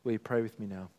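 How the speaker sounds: usual speaking voice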